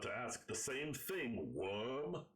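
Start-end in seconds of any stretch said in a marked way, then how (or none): none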